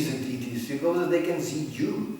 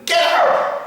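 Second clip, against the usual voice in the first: high, loud yell; shouted get out; 14 dB louder than usual. Retold or imitated. Person in the first car chasing him off, a high, loud yell. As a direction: throw the line at him. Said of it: "was going".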